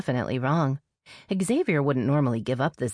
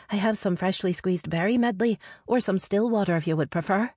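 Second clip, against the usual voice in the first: higher-pitched sister's voice; nervous sister; 6 semitones higher than usual. Acted. Sister offering her brother a drink, higher voice. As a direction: lift the voice high for the sister.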